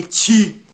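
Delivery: "ti" with a hard t, no ch sound; Bahian hard T. Retold or imitated. Visitor describing how Bahians say T, hard "ti". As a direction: say "ti" with a plain dental t. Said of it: "Eles falam de ti".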